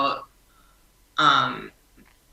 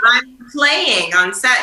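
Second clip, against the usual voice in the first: playful excited; 7 semitones higher, 6 dB louder, slower than usual. Retold or imitated. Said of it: "to be like".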